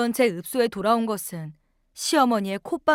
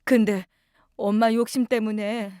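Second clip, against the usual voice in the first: weak sick mother. Acted. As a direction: speak gently, barely awake. Sick mother answers daughter. (혼수에서 깨어난 엄마, 느리고 힘없는 다정한 목소리)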